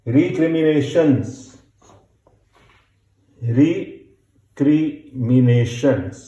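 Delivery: recriminations stretched out at a very slow pace; very slow dictation. Shown as a demonstration. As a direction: dictate recriminations slowly, syllable by syllable. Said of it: "dictation teacher".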